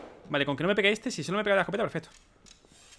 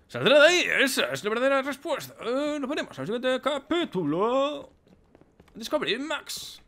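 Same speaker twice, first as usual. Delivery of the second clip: documentary narrator; 7 semitones higher than usual. Pretend.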